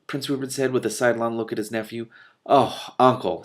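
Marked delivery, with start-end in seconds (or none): 2.5-3.4 exasperated. A narrator's reading. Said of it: "in an exasperated tone".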